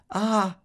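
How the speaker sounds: high, loud breathless sigh; panting sigh. A narrator's reading. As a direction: gasp out of laughter. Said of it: "panting, sighing".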